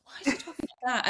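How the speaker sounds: high, quick imitation of startled classmates; baffled classmates. New girls hearing the Scottish accent, baffled imitation. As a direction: mimic puzzled disbelief without raising the volume. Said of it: "everyone was like".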